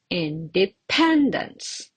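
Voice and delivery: Independence, slow, with PEN stressed; indePENdence, teacher's slow model; stress on third syllable. Shown as a demonstration. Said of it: "the syllable with the typo".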